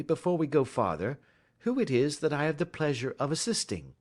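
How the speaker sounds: polite detective taking charge, lightly voiced; lightly voiced Holmes. A narrator's reading.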